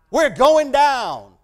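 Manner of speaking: loud, slow, terrified cry; shriek of fear. Retold or imitated. Sailors on the stormy sea cry out in terror. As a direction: cry out that the boat is sinking. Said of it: "they shrieked in fear".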